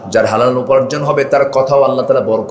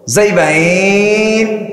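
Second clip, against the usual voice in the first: Mymensingh drawl; 7 semitones higher, much slower than usual. Shown as a demonstration. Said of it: "আছে না এরকম টান মারে না".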